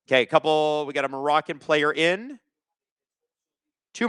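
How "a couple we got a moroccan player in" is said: usual speaking voice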